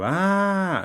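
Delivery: high, loud drawn-out "ah"; startled scream. Acted. Sleeper waking to a floating eyeball, startled scream. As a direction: cry out in alarm, long and high.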